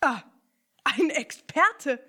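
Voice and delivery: loud, slow, impressed exclamation; called out. Acted. Ernie exclaims to Picondu, loud and impressed. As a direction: call it out, impressed. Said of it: "rief Ernie".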